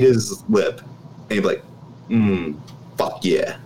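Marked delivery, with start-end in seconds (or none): 2.1-3.6 creepy lip-biting groan. Retold or imitated. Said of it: "the Benny look".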